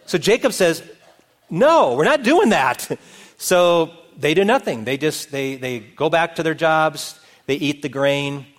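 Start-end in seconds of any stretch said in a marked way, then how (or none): 1.5-2.9 higher, drawn-out indignant no; emphatic refusal. Retold or imitated. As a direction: refuse it like an exasperated father. Jacob flatly refuses his son's offer.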